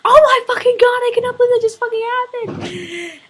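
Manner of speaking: high, loud squeal of disbelief; screaming excitement. Retold or imitated. Fan screaming to her friend after meeting the band, high and loud. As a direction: shriek it at your friend.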